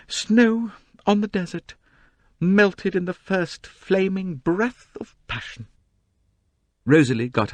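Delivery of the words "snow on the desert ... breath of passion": slow, high, breathless melodrama; hammed-up romantic novelist reading; swooning recitation. A narrator's reading. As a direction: savour every word like purple prose. Dramatic recitation of title.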